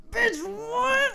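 high, shouted disbelief; shocked outburst. Real, not acted. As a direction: cry out at the shocking twist. Shocked reaction to the plot twist.

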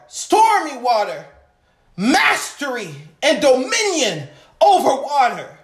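ordinary talk, nothing marked

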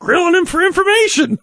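very high squeaky little elf; Alfie voice. Acted. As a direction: squeak like a tiny elf scolding Daddy. S2 voicing Alfie.

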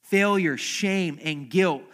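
loud, high cries of each word; shouted. Acted. Pastor cries out Peter's failure, shame and guilt loudly. Preacher names Peter's burden.

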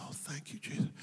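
breathless whispered relief; whispered thanks. Retold or imitated. Hiding man whispers thanks to Jesus in relief. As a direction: whisper your thanks to God.

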